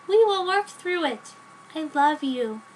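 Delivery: put-on high, bright girlish voice for the heroine; Joan's voice.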